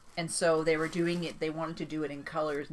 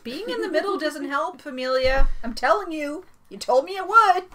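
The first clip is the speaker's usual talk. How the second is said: nagging mom; 8 semitones higher, 10 dB louder than usual. Retold or imitated. Mock nagging mother voice.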